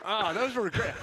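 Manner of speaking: high, laughing delight. Real, not acted. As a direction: laugh through the praise. Laughing at commercial memory.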